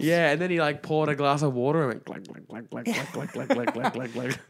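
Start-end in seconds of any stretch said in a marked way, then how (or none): 2.0-4.4 rapid glug-glug noises; imitated gulping. Podcaster imitates mime drinking water, comic gulping. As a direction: mimic someone downing a drink.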